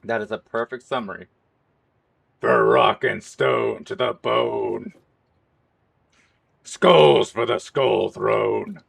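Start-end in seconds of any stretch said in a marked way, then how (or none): 2.4-8.8 slow, loud, gruff war cry; soldier impression. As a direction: roar it like a soldier rallying troops. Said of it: "my stinking soldier impression".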